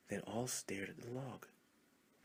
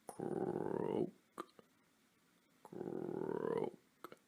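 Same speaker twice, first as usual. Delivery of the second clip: drawn-out croaking frog sound. A narrator's reading. Storyteller croaks for the frogs in the creek. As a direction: croak low and long like a frog.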